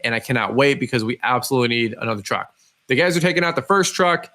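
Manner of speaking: normal talking voice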